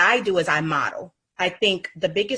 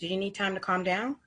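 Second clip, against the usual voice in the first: soft rising question; 3 semitones higher, 6 dB quieter than usual. Shown as a demonstration. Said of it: "you know".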